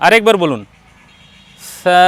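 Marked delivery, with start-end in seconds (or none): 1.8-2.0 সা said softly, with a long hissy consonant and vowel; soft letter sound. Shown as a demonstration. Teacher has learner repeat the letter, soft pronunciation of সা. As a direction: say সা gently, drawn out. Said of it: "নরম করে".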